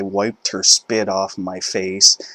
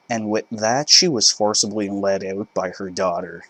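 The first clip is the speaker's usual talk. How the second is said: plain reading voice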